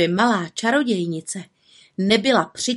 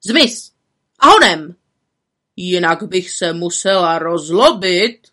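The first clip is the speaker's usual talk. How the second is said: louder, clipped order; stern command; 5 dB louder than usual. A narrator's reading. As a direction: send her off with a warning. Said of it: "přikázala vrchní čarodějnice".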